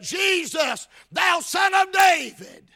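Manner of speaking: preacher acting a man's pleading holler; crying out. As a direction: cry out, building to a shout. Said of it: "did you notice he cried".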